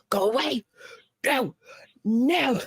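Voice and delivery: mild mock yell of go away; half-hearted yell at a loose dog; retold scream. Owner yelling at an approaching dog, mild mock shout. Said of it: "and I'm screaming".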